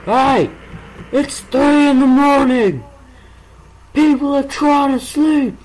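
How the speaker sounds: high, slow outraged yell; furious shout. Acted. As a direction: bellow like an angry neighbour. Yelling at noisy mobs at night.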